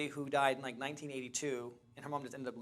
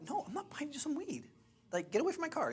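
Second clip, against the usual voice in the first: exasperated refusal; 12 semitones higher than usual. Retold or imitated. Refusing the woman.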